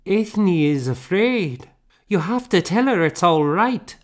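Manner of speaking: put-on a small child pleading for her doll; lightly voiced little girl. A narrator's reading.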